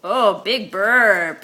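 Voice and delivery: usual speaking voice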